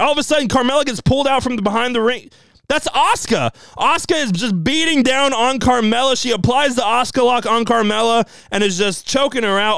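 high-pitched, rushing play-by-play; breathless, thrilled retelling of the ambush; excited recap. Real, not acted. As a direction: spill it out like a thrilled fan. Excited recap of the surprise attack.

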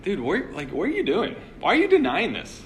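mock-baffled questions, pitch jumping high; incredulous bystander. Bystander to Peter about his obvious accent, incredulous. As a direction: question him in high-pitched casual disbelief.

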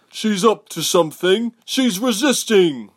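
high, loud drawn-out alarm; yelling. A narrator's reading. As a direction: yell a warning to the squad. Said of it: "one yelled".

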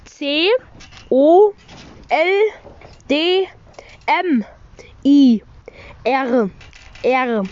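slow letter-by-letter spelling. Shown as a demonstration. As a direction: spell each letter with pauses. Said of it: "Ich buchstabiere es".

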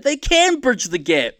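high, loud excited cheer for the underdog; hopeful cheering. Retold or imitated. Man watching the Olympics cheering loudly and high-pitched. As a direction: cheer him on, voice jumping up high. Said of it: "I'm like".

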